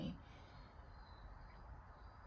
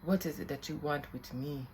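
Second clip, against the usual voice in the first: poor put-on African accent; 5 semitones lower than usual. Spirit asks the medium's purpose, poor African accent. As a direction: lower voice in a faked African accent. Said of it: "this is my poor acc-, accent".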